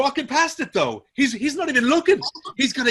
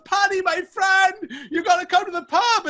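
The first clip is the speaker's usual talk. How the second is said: put-on sloppy happy drunk; 9 semitones higher than usual. Drunk Sean hailing his friend from the pub. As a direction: call him in like a merry drunk. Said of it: "and he goes".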